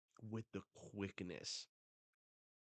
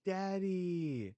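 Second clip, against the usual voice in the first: high, drawn-out shout for a parent; child calling out; 10 semitones higher, 12 dB louder than usual. Acted. Cullen calls to his daddy, shouting. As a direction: yell it as a little kid, much louder.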